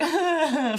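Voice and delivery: high, loud mock crying; imitated child's wail. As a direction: briefly wail like a toppled kid. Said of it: "they might be like".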